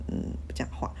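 whispered non-answer; silent mumble. Retold or imitated. Daughter dodging mom's question, whispered. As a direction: breathe it out, not daring to speak.

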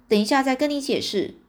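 plain reading voice

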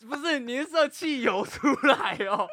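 high, loud laughing disbelief; can barely speak for laughing; laughing loudly. Real, not acted. Laughing disbelief at absurd story. (边狂笑边说)

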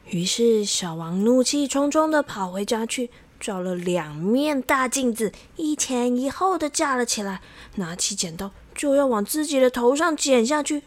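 ordinary delivery, nothing marked

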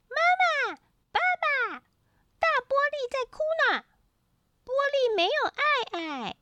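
put-on very high-pitched little child voice; high-pitched toddler urgently reporting; toddler calling parents. A narrator's reading. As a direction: cry out in tiny baby talk. Toddler runs home calling parents.

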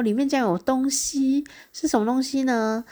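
high-pitched, teasing reveal. Retold or imitated. Reveals a surprise inside the box. (提高音调，制造悬念)

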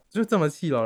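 ordinary talk, nothing marked

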